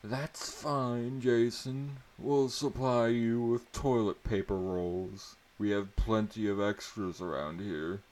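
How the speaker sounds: lightly voiced dry teacher jab. A narrator's reading.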